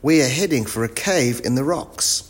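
calling out. A narrator's reading. Wilf warns of the cave, calling out. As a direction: call the warning, fairly controlled. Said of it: "called Wilf".

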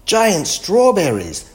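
high, loud storybook exclamation; excited discovery. A narrator's reading. Wilf shows the others the strawberries, excited exclamation. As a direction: announce an amazing find with big pitch swoops.

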